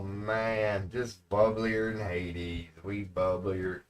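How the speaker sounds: usual speaking voice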